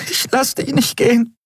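high-pitched, pleading protest; cling to him and refuse, voice pitched high; desperate refusal. A narrator's reading. (verzweifelt und mit hoher Stimme flehend)

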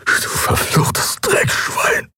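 slow, strained whisper full of loathing; whispered curse. A narrator's reading. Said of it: "flüsterte er".

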